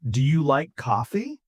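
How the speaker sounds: "coffee" rising at the end; rising intonation. Shown as a demonstration. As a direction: lift the voice on "coffee" as a yes-no question. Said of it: "My voice goes up on coffee".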